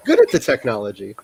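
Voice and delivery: ordinary talk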